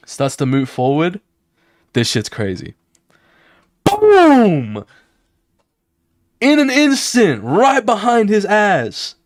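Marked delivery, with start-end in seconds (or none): 3.8-4.8 shouted high boom sound effect; loud boom. Acted. Narrating the fight to viewers, high loud boom effect. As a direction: yell out the impact like a cartoon hit.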